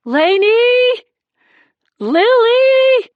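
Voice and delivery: high, loud, anguished howl of names; anguished screaming. A narrator's reading. Husband howling for his wife and daughter, anguished scream. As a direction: scream the names in raw despair. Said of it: "the screaming started, a heartrending howl".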